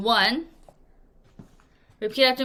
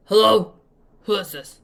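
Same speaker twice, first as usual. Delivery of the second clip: busy dad snapping into the phone; short unfriendly phone answer; impatient hello; gruff; 8 dB louder than usual. Acted.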